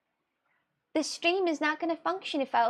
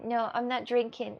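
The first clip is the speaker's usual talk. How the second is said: usual speaking voice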